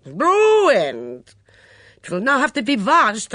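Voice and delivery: put-on high, loud foreign-accented outrage; furious torrent. A narrator's reading. Mitzi scolding over her kitchen pans, in a furious torrent. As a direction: rant indignantly, wailing up to a peak. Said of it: "Mitzi burst into a torrent of words".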